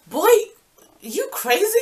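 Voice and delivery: high, loud, disbelieving question with a pause; incredulous retort. Retold or imitated. Answering the ex who asks her for advice, incredulous. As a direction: act out scornful disbelief.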